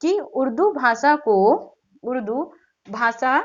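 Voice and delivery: slow, spaced-out dictation for note-takers; measured teacher's dictation with pauses between chunks; dictating. Shown as a demonstration. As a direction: dictate it slowly, phrase by phrase. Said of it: "लिख लीजिए".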